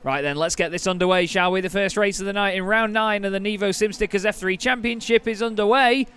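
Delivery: loud, excited race call; motorsport commentator. Acted. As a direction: call the race start at full pitch. Live race play-by-play at start.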